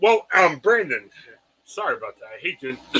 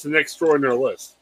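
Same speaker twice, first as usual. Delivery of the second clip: ordinary talk, nothing marked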